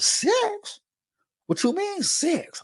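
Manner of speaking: high, slow incredulous voice imitating an inmate; stunned high-pitched protest; mock outrage. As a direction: play the guy who can't believe his sentence. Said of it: "I hear another dude talking about".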